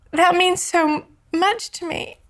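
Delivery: high, choked-up thanks; tearful. Retold or imitated. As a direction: retell it close to crying. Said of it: "I just started to cry".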